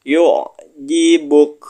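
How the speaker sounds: slow chanted Arabic; Yu'jibuk recited slowly, final kaf breathed out; Quran recitation. Shown as a demonstration. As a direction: intone it like a tajwid teacher. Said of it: "Kita baca bareng-bareng".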